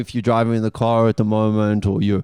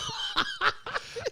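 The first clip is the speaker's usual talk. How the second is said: shrill squeal of laughter; high squealing laugh; 12 dB quieter than usual. Real, not acted. Laughs at wife joke.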